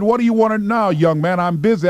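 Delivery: a fed-up station boss; irritated boss. Retold or imitated. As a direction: snap at the pestering kid. Annoyed boss brushing off applicant.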